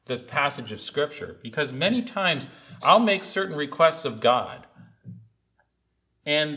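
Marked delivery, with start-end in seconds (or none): none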